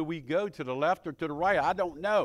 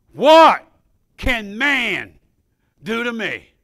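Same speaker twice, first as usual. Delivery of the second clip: loud high "What", then driving on; preaching cry; 4 semitones higher, 13 dB louder, slower than usual. Acted. Preacher challenges the church with the psalm, loud. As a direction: throw the question out as a challenge. Preacher reads verse forcefully.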